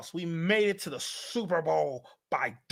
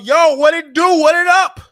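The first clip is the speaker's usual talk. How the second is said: hype greeting; 9 semitones higher, 11 dB louder than usual. Real, not acted. Greeting new fan club members.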